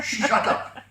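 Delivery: mock him while laughing. Devil silencing the Finn.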